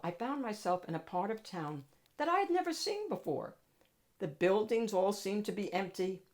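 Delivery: ordinary delivery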